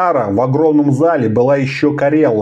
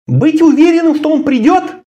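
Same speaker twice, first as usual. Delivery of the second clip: high, louder surprised echo; 14 semitones higher, 3 dB louder than usual. Acted. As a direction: throw the words back in disbelief. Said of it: "удивлённо посмотрела".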